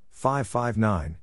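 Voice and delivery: ordinary talk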